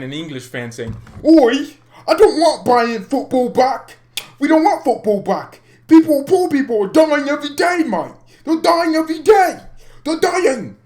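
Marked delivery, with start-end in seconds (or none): 1.2-10.8 loud, high, hammed-up British fan; British accent. English fan objecting to football coming back, shouted British accent. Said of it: "That's why I'm doing the British accent".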